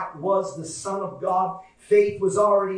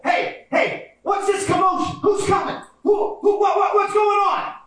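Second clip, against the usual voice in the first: high, urgent calling out; excited questioning; 10 semitones higher than usual. Acted. Blind men asking people about the commotion, high urgent excitement. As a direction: stammer out eager questions, pitched high.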